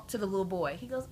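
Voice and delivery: ordinary talk, nothing marked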